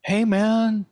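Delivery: ordinary talk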